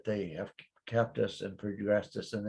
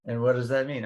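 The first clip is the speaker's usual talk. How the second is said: ordinary talk, nothing marked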